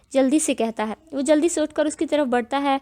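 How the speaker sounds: ordinary delivery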